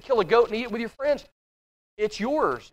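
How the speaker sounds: ordinary delivery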